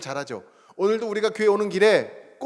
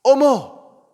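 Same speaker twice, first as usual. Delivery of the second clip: high, loud delighted gasp of surprise; surprised exclamation; 7 semitones higher, 8 dB louder, much slower than usual. Retold or imitated. Church member thanking pastor, surprised "어머!". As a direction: cry out in happy surprise. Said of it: "첫 마디가 이거예요".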